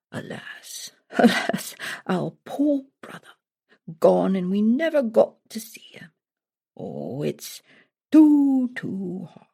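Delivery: whispered mock lament, hammed-up grief; badly faked weeping whisper; fake crying. A narrator's reading. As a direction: put on a sham sob over the brother. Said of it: "The king started to cry".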